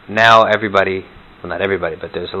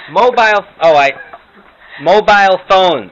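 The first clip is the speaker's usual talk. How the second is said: put-on high, loud, confused old grandma; 8 semitones higher, 5 dB louder than usual. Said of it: "some Morty and Herta imitations".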